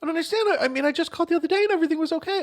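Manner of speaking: put-on high, rushing old lady voice; high worried old lady; alarmed elderly woman. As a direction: panic gently like a grandma, speeding up. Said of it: "old woman's reply".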